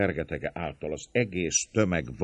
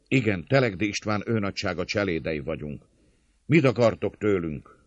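raised, higher answering voice; loud reply; 5 semitones higher, 5 dB louder than usual. A narrator's reading. Servant leader replying to the attackers, loudly. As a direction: answer back firmly across the gate.

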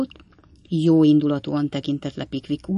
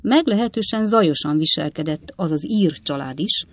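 usual speaking voice

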